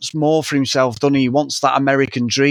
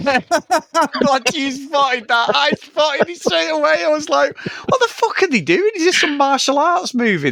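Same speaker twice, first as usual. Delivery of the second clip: real laughter, voice pitched way up; laughing incredulity; 12 semitones higher than usual. Real, not acted. Co-host agreeing about shocking extras, laughing in disbelief. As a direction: squeal it through laughter, incredulous.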